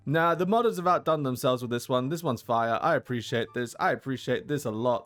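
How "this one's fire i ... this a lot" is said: usual speaking voice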